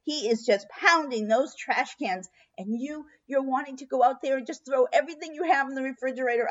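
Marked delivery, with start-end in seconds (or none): none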